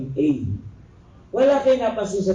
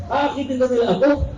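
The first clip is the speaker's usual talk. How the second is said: raised, quick shocked retelling; 5 semitones higher, faster than usual. Real, not acted. Teacher shocked by a student's request, raised and quick. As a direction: blurt it out shocked and a little fast. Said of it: "Nasyak ako eh".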